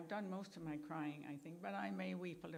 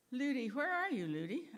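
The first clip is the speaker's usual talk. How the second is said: calling out; 6 semitones higher, 6 dB louder than usual. Real, not acted. Calling a guest in the room.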